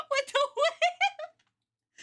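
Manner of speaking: very high, slow squeal through laughter; hysterical laughing. Real, not acted. Streamer picturing villain with whip, squealing with laughter. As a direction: barely get it out, squeaking with laughter.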